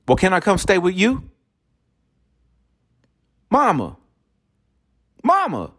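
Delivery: high pleading wife voice; wife calling mama. Retold or imitated. Wife asks her mother to take her in, high and pleading.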